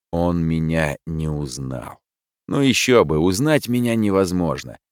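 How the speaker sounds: plain reading voice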